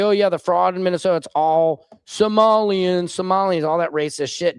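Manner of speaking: hammed-up imitation of a dismissive commenter; mock imitation. Imitating the viewer he expects to answer, mocking. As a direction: parody the viewer brushing it off. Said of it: "'cause I'm sure you're gonna say".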